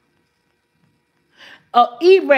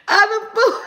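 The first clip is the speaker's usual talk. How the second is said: giggling high through the words; real giggle breaking in; laughing; 8 semitones higher than usual. Real, not acted. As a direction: laugh it out, pitch up. Laughing at food answer.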